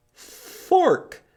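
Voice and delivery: high, loud reveal; excited reveal. Shown as a demonstration. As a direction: pop the word out with delight. Big reveal of the object.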